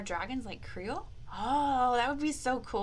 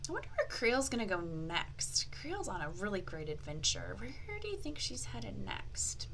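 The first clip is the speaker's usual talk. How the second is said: soft whispered curiosity; whispered wonder; 7 dB quieter than usual. Acted. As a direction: whisper as if sharing a secret.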